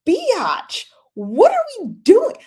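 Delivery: very high, loud mock outrage; hammed-up scolding squeal; exasperated retort. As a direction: yell at the screen in high disbelief. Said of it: "And I'm like".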